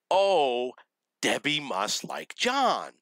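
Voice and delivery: high, drawn-out mock epiphany; dawning realization. Acted moment of belated realization.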